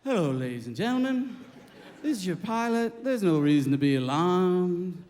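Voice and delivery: mock-calm cabin announcement, dipping low; mellow pilot. Pilot announcing an emergency landing to passengers, eerily mellow. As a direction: imitate a too-mellow captain on the PA. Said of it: "The pilot's all mellow".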